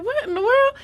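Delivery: high astonished question; disbelief. Retold or imitated. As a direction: ask in stunned disbelief. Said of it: "I was like".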